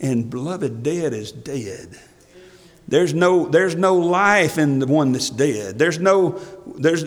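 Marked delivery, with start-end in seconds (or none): 0.0-2.0 much softer, slow and low; hushed and grave. Acted. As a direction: let it drop to a grave hush. Solemn conclusion after God's word.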